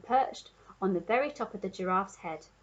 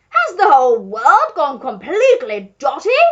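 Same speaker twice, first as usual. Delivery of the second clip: loud, higher, scandalised roar; duke bellowing; 4 semitones higher, 15 dB louder than usual. A narrator's reading. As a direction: bellow in utter disbelief at these creatures. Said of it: "he bellowed".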